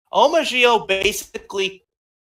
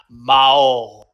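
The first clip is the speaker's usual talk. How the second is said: imitating the villain; 8 semitones lower, 6 dB louder than usual. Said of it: "let me see if I can try and emulate".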